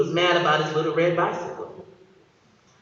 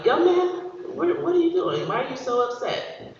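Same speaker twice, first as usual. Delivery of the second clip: concerned cop; 8 semitones higher than usual. Retold or imitated.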